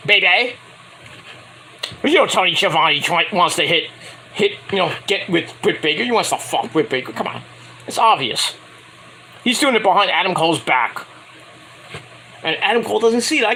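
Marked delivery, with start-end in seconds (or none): none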